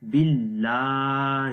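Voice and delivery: "باللہ" with a thin, light lam, slowly chanted; thin-lam recitation; thin Allah. Shown as a demonstration. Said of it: "ہا کی آواز".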